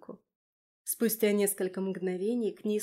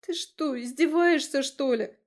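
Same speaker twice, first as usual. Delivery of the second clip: moaning; 5 semitones higher, 5 dB louder than usual. Acted. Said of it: "простонала".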